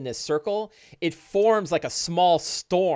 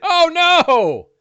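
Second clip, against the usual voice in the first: loud, high mock wail; dramatic dismay; 10 dB louder than usual. Game master reacts to a critical hit, loud dramatic dismay. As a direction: cry out as if doomed.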